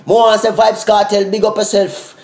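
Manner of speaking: ordinary talk, nothing marked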